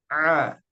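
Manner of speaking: A as Arabic ain from mid-throat; throaty ain on A; ain sound. Shown as a demonstration. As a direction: squeeze the A in the middle of the throat, not the nose. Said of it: "suaranya seperti ini kalau ain".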